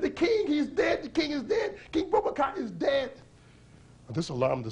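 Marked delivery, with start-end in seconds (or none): 0.0-3.1 very high, urgent shout; frantic cry. Acted. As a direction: call the crowd in panic. Lady cries to soldiers.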